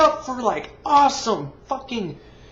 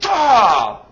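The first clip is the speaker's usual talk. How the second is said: loud, raw shout of frustration; frustrated scream; 2 semitones higher, 7 dB louder than usual. Real, not acted. Reviewer fed up with the aliens, real frustrated yell. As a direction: let out a real angry yell. Furious at the movie.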